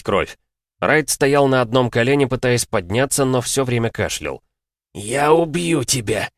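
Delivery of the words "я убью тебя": slow, high, strained werewolf threat; growled threat. Acted. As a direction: snarl it out slowly. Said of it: "прорычал он". (прорычать угрозу)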